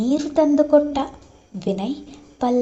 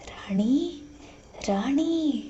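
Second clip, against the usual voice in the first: softer, hushed call of a name; 7 dB quieter, much slower than usual. A narrator's reading. Mada searching the dark house for Rani, calling softly. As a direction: call out gently so no one wakes. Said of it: "ಮೆಲ್ಲು ದನಿಯಲ್ಲಿ ಕರೆಯುತ್ತಾ".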